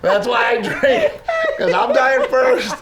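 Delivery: loud, high, drawn-out mock despair; comic outburst. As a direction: holler it hammed-up, laughing between lines. Comic outburst after the sad story.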